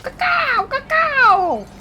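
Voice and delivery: high yelled "cacao"; shouted call. Acted. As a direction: belt it out high.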